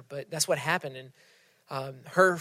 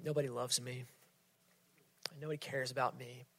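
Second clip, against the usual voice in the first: soft, sinking, much quieter lines; hopeless daughter; 3 semitones lower, 14 dB quieter, faster than usual. Retold or imitated. Sermon story of a daughter who feels unloved, hushed and hopeless. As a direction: let each line fall away like she's given up. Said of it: "she's like".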